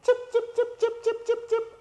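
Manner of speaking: very high, soft chick cheeps; chick cheeping. Shown as a demonstration. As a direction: squeak it like a tiny chick. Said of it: "so chick says".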